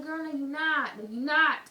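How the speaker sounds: loud, firm no to her friend; firm loud refusal. Retold or imitated. Telling her shoplifting friend no, loud and firm. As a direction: shut her down, loud and final.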